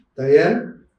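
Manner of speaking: usual speaking voice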